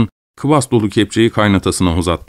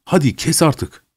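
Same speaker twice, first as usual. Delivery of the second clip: lightly acted exasperation, pitched up; impatient husband; 7 semitones higher than usual. A narrator's reading. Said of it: "Stepan karısına doğru eğildi".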